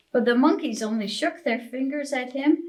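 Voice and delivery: ordinary delivery, nothing marked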